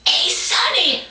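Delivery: high-pitched granny calling out; hammed-up high granny voice. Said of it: "takes your granny cane and goes".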